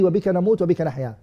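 "Wa bika namut wa bika nahya" enchaîné vite et plus doux; wrong order, rushed. Shown as a demonstration. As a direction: rattrape la mauvaise version d'un trait. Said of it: "You don't say".

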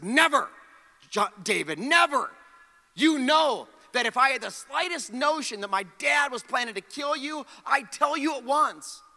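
insisting loud and high, declaring loyalty; earnest protest. Retold or imitated. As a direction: swear it to your friend, raising your voice. Jonathan's vow to David.

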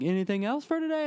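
high, light cashier voice. Retold or imitated. Cashier asks him at the counter, high and chirpy. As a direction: chirp it like a bored clerk. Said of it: "the guy at the counter is like".

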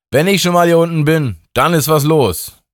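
high, loud boast; party god showing off; god Justin. A narrator's reading. God Justin brags after a swig from the bottle, high and loud. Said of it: "nahm einen tiefen Zug und sagte".

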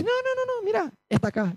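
high, eager interrupting mock voice; mock eager friend. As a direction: jump in eagerly like Maga. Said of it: "que dice". (Maga interrumpe entusiasmada, voz aguda)

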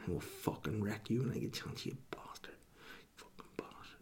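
vicious unvoiced whisper; muttered inner threat; seething whisper. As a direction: hiss it under your breath. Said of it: "in his mind".

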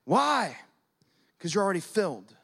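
God's firm refusal. Pretend. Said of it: "and he's like".

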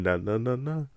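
a hushed, haughty waiter turning someone away; lightly voiced fussy doorman, very soft; snooty maitre d'. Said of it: "He was a maitre d' that told Spider-Man".

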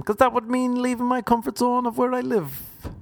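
very high mock excuse; comic high-pitched self-justifying; defensive begrudger. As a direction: protest in a high, put-on voice. Begrudger answering his questioner.

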